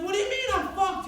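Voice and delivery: high, loud shocked question; alarmed yell. Retold or imitated. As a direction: shout back in alarm. Alarmed reply.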